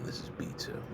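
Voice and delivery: whispering B two under her breath; whispered correction. Real, not acted. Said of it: "oh, I did this wrong".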